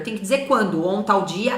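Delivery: normal talking voice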